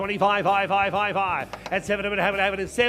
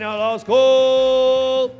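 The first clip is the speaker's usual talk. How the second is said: very slow, loud last call; drawn-out final call; 3 semitones higher, 6 dB louder, much slower than usual. Real, not acted. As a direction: hold the last call long.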